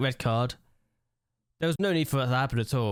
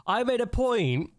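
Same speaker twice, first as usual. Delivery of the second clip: higher and slow, fed-up restart; exasperated restart; 9 semitones higher than usual. Real, not acted. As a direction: start again, exasperated. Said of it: "I was about to say".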